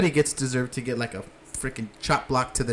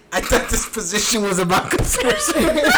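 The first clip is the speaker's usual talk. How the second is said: mock indignant quote; 6 semitones higher, 3 dB louder than usual. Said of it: "He said".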